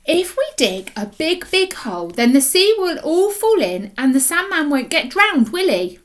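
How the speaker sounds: put-on high, hopeful child's voice; young girl pleading her idea; eager little girl. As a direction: pitch it up like an excited small girl. Little girl's hopeful plan.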